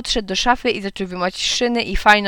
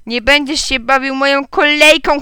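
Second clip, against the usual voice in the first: put-on loud, higher childish ban; shouting; 5 semitones higher, 6 dB louder than usual. A narrator's reading. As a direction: forbid it with a raised voice. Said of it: "krzyknęła Otylia". (gniewny okrzyk)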